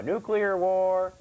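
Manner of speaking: ordinary talk, nothing marked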